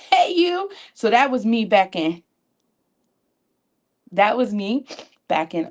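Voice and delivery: usual speaking voice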